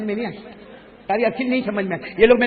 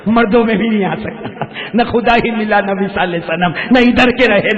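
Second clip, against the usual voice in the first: loud punchline; 3 semitones higher, 8 dB louder than usual. Real, not acted. Preacher rhyming punchline to crowd.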